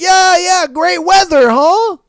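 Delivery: high, loud mock small talk; awkward cover-up. Man covering a failed pickup line, in loud, high mock cheer.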